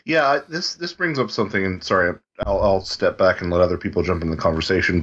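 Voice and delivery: normal talking voice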